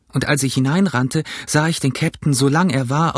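plain reading voice

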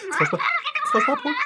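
a sudden high alarmed question; startled call. Acted. On the phone to Frau Sparbrot, startled. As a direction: voice jumps high, asking into the phone.

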